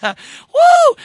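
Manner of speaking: high, loud joyful holler; preacher caught up in a shouting spell; ecstatic whoop. Real, not acted. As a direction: let out a sudden woo of praise. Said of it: "I'm having a shouting spell".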